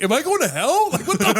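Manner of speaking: high, loud, laughing disbelief; panicked inner voice. Retold or imitated. Retelling his inner panic to a friend, high and laughing. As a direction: freak out inside, half laughing.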